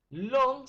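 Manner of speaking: "Lon" hit loud on its own; stressed syllable. Shown as a demonstration. Teacher showing pupils the tonic syllable, stressed "Lon". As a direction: punch out "Lon" as the strong syllable. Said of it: "sería la sílaba más fuerte".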